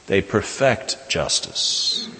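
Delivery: "They perfect justice" whispered, very slow; hushed emphasis. Acted. Preacher repeating it softly for the congregation, hushed.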